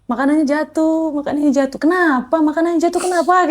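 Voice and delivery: imitating her own upset crying voice; retold tearful panic. Courier recalling her dropped food order, mock tearful panic. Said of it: "Saya langsung nangis".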